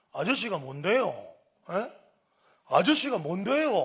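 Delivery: loud, high insolent challenge; cocky teen shouting back; defiant talking back. Retold or imitated. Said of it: "제게 대들더라고요".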